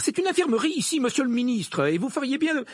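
high-pitched indignant scolding; angry. A narrator's reading. Woman reminds the minister it is an infirmary, angrily. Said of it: "répondit celle-ci avec colère".

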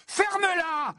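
very high furious yell; strained high-pitched shout; bellow. A narrator's reading. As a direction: yell to shut her up. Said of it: "beugla Ron".

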